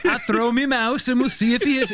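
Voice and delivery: a silly London thug offering his mouse; Cockney henchman. Pretend. Henchman offers to throw his mouse at lasers, high hammed-up Cockney.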